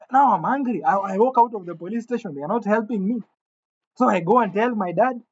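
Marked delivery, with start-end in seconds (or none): none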